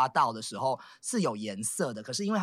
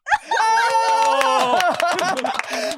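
loud shrieking laugh; 8 dB louder than usual. Real, not acted. Laughing after dialect demo.

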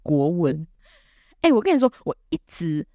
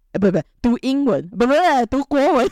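rising, louder, faster flustered correction; mock self-correction; 6 semitones higher, 4 dB louder than usual. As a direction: catch herself in a comic panic. Correcting herself in mock panic.

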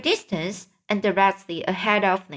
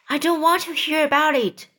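quick, higher-pitched protest; mild shriek; 6 semitones higher, faster than usual. A narrator's reading. Frightened earthworm refuses to hear of cloud men, mild shriek. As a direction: blurt it out high and fast, short of screaming. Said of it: "shrieked the poor blind earthworm".

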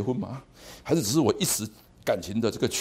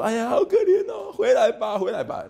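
mock pity; 9 semitones higher, 4 dB louder than usual. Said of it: "然后每次都说".

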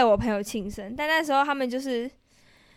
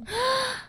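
sharp high gasp; quick gasp of shock at the gross cake; 11 semitones higher, 6 dB quieter than usual. Real, not acted. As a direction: gasp in disgust. Hears raw durian.

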